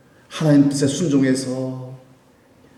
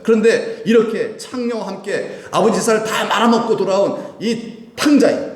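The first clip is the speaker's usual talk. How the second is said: higher-pitched, contemptuous accusation; scornful elder son; 8 semitones higher than usual. Acted. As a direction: spit out a mildly acted grievance about the brother. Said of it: "아주 멸시하면서". (동생을 멸시하는 화난 큰아들)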